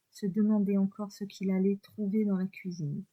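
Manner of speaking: ordinary delivery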